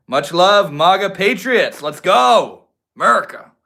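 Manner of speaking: loud, high patriotic hollering; hammed-up patriotic shout; rallying cheer. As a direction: holler it like a playful rally cry.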